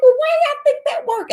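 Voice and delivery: high, loud "why y'all think that work?"; incredulous outburst. Retold or imitated. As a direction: throw the question back in disbelief. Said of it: "And I'm like".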